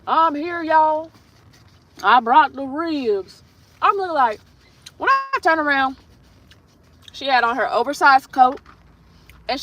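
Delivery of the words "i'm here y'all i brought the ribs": high, exaggerated twang imitation; twangy accent. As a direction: mimic her twang, higher and drawn out at the end. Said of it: "twang in her voice".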